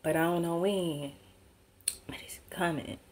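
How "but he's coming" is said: whispered. Real, not acted. Ominous warning repeated.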